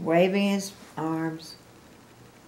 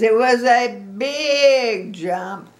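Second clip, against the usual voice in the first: slow, loud, higher insisting; Piggy insisting; 6 semitones higher, 10 dB louder, much slower than usual. A narrator's reading. Piggy to Gerald, slow proud insistence. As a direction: drag out the big jump proudly. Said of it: "Piggy says".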